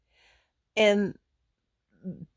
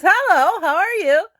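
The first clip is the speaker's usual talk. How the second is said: mock greeting; 10 semitones higher, 6 dB louder than usual. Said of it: "He goes".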